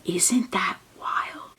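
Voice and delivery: slow whispered aside. Real, not acted. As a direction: lean in and whisper it to the viewers.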